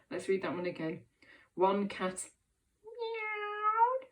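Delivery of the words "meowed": high, drawn-out "meowed"; meowing like a cat. Shown as a demonstration. Teacher re-reading for children, meowing like a cat. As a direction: say "meowed" as a high cat's meow. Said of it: "in a meowing and purring sort of way".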